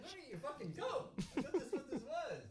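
high, breathy giggling rant; laughing squeaky voice. Pretend. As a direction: talk through the giggles, squeaky.

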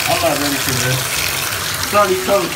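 startled by the cold water; loud, jolted reaction to cold; shocked gasp. Real, not acted. First touch of cold water.

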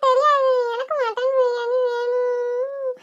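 put-on slow, sobbing child's demand; crying tantrum. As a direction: wail it out like a spoilt little girl. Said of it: "எதுக்கு இப்ப அழுதுகிட்டு இருக்க?".

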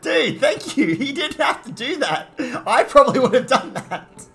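high, loud, delighted laughter in the words; laughing thanks. Real, not acted. Streamer thanking a viewer for a gifted sub, laughing. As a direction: thank them through real laughter.

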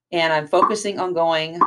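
ordinary delivery

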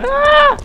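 high sudden scream; startled scream. Real, not acted. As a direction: scream in fright. Sudden scare.

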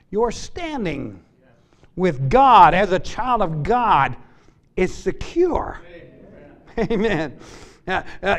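ordinary delivery

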